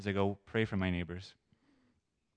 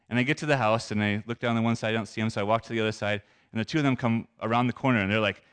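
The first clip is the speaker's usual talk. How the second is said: ordinary talk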